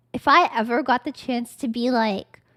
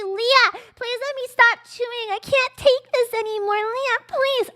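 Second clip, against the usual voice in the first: higher, begging, mock-pitiful plea; pleading victim voice; 7 semitones higher than usual. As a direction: play the victim begging for mercy. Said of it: "You're gonna say".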